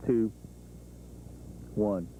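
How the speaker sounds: normal talking voice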